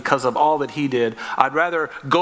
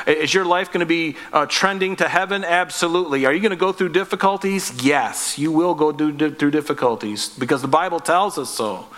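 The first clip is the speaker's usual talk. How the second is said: ordinary talk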